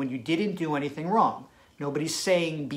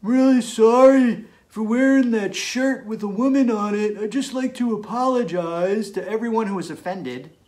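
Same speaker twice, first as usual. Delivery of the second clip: lightly hammed-up apology, higher and louder; mock public apology; 6 semitones higher, 8 dB louder than usual. A guy mock-apologizing to the public, hammed up. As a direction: play the sheepish guy saying sorry to everyone. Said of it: "he says".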